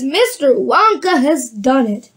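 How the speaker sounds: usual speaking voice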